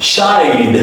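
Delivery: 'shied' as shy-d; long glide in 'shied', ending in a voiced D. Shown as a demonstration. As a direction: model 'shied' with its diphthong drawn out. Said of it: "so you pronounce it as".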